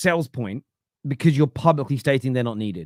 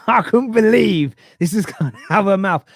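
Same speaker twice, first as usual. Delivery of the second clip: louder, higher, laughing through it; laughing disbelief; 7 semitones higher, 6 dB louder than usual. Real, not acted. Father recounts his daughter's question, laughing aloud. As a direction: laugh while telling it.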